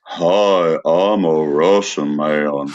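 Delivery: slow, low put-on Russian accent. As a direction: drawl it as a cartoon Russian. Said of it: "I'm gonna do Russian".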